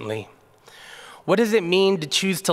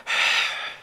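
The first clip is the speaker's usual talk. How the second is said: long unvoiced sigh of a weary husband; deep sigh; 3 dB quieter than usual. Husband sighs at his wife's request, a weary exhale. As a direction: breathe out a tired, put-upon sigh. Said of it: "he takes a deep breath".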